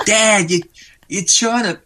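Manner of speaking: put-on squeaky little boy teasing his dad; imitating his son. Son teasing dad about his American accent, high kid voice. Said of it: "kills himself laughing".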